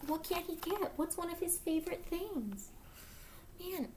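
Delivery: usual speaking voice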